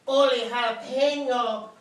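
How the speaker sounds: a mild excited "Ole jalapeno"; a touch of a Spanish exclamation; exclaimed. Acted. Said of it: "exclaimed Skippy John Jones".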